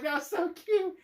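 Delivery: high, laughing coo; giggly gush. Retold or imitated. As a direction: squeak it out through laughter. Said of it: "I was like".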